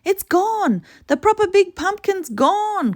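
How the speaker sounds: high, loud wail; distressed cry. Acted. Girl cries out from the tree that the pumpkin is lost, high and loud. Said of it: "cried Betty".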